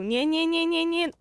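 rapid high protest of nie nie nie; panicked string of protests at the game; frantic no-no-no. Real, not acted. Wrong horse comes again. (gwałtowne, wysokie „nie, nie, nie”)

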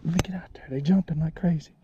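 hushed whisper so the fish stay calm. Real, not acted. Dad whispering to his son by the jumping fish, hushed. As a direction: whisper it, don't spook the fish.

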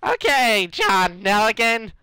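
high put-on character voice; horrible character attempt. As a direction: pitch it up into a silly character voice. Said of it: "Nah, that's horrible".

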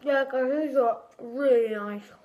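chewing candy while talking; talking while eating sweets; mouth full. Real, not acted. Seen 0: Hand at mouth eating laces until 2.7, then stretches them while talking.

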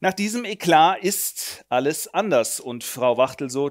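ordinary delivery

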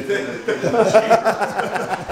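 high breathless laughing; giddy laugh. Real, not acted. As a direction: laugh giddily. Laughing after sauna.

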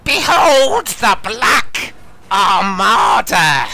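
huge, slow, high roaring proclamation; bellowing. Acted. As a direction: bellow it across the harbour. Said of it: "as he bellows".

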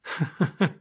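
little rising giggle; laughter she fights against; hysterical laugh. Acted. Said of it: "È stupido ridere".

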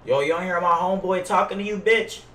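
ordinary delivery

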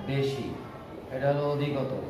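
usual speaking voice

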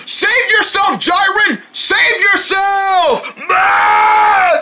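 high shouted, drawn-out plea; high frantic scream; panicked warning. Acted. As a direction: yell it while running away. Fleeing the falling foxes.

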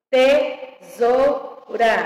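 very slow "Tesoura", te-sou-ra in separate beats; syllable by syllable. Shown as a demonstration. Teacher separating words for pupils, syllable by syllable. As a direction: split "Tesoura" into spaced syllables. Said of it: "separação de palavras e sílabas".

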